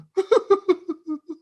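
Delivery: high, loud burst of laughter; a hearty laugh after the story. Real, not acted. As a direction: let out a big laugh. Laughs after retold plea.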